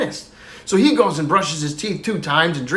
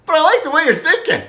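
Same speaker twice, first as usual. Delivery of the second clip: higher-pitched echo with a laugh in it; Johnny echoing teacher; 10 semitones higher, 4 dB louder than usual. Acted. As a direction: throw the teacher's line back, pitched way up. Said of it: "He says".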